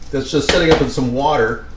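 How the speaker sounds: ordinary talk, nothing marked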